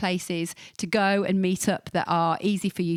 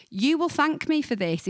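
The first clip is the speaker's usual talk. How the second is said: ordinary talk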